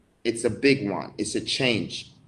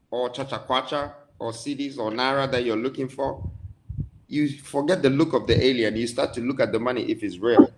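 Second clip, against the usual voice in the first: ordinary delivery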